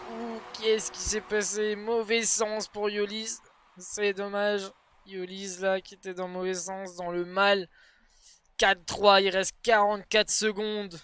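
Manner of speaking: tense live play-by-play; esports caster. Acted. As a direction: build tension to the goal. Live Rocket League match commentary.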